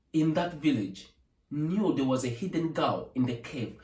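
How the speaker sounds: ordinary delivery, nothing marked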